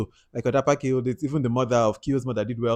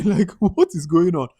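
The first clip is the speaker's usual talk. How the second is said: slow, laughing what is going on; laughing disbelief; 6 semitones higher, 5 dB louder, slower than usual. Real, not acted. Vlogger to viewers, laughing in slow disbelief. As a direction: chuckle through the question in disbelief. Baffled by Kiyo believing mother.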